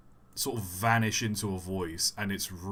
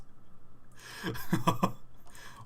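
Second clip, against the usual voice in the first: breathy laugh; 5 semitones higher, 5 dB quieter than usual. Real, not acted. Laughs at frog catch.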